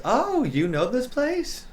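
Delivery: high, drawn-out curious lilt; slow, high-pitched playful query; date's surprised question. Acted. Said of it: "she walks in and goes".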